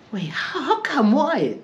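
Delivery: high, rising disbelieving questions; mock shock. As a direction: act baffled she hasn't been.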